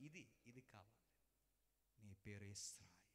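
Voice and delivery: hushed whisper of a new name. Acted. God telling Jacob his new name, whispered. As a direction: breathe the name like a secret.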